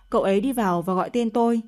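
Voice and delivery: ordinary delivery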